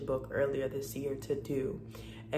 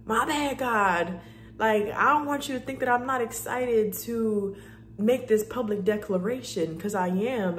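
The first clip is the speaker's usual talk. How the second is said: higher and louder earnest apology to God; apologetic to God; 6 semitones higher, 4 dB louder than usual. Retold or imitated. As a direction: quickly take it back, eager to show she cares. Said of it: "I had to just be like".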